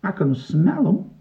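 slow, high sniffing character voice. A narrator's reading. Pongo's mate smells the oranges, slow and high. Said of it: "sniffed his mate".

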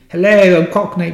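loud, high mock Cockney; bad Cockney accent. Shown as a demonstration. Podcaster shows off his poor accents, loud Cockney. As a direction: do a hammed-up Cockney greeting. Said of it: "I can do, like, a Cockney".

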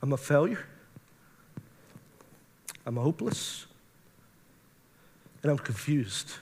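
low, quiet confession with long pauses; subdued retold admission of defeat; dejected. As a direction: admit it softly, as if defeated.

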